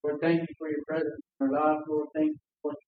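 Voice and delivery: ordinary delivery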